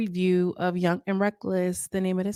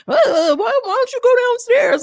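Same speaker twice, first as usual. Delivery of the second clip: mock high, thin man's voice, much higher and louder; squeaky man voice; 6 dB louder than usual. Mocking Tilo's squeaky plea to go downstairs. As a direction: squeal it like a nervous man asking timidly. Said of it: "no man got no little squeaky voice".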